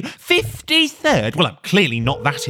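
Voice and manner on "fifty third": high, slow disbelieving echo; incredulous. Real, not acted. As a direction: repeat it in shocked disbelief. Shocked at queue position.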